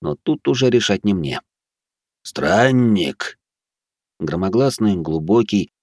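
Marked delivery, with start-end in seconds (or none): 2.2-3.4 eldritch horror. Acted. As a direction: call out like an ancient guardian. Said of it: "потусторонний голос". (потусторонний громогласный голос)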